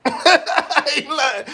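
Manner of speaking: a high laugh, then slow words through it. Real, not acted. Telling friends he tried to run them over, laughing. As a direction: laugh as you insist. Laughing at his own story.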